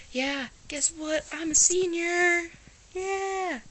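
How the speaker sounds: high, slow excited cheer; squealing with excitement. Real, not acted. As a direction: shout it out with glee. Announcing she is a senior.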